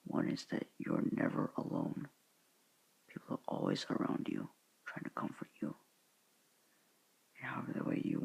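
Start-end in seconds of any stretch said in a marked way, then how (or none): none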